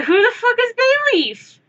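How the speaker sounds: imitating my own confusion; hammed-up who-is-that; baffled. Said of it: "And I'm just like".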